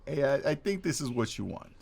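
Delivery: lightly voiced New York accent; a hint of New York attitude. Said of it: "from a New York to a Southern accent".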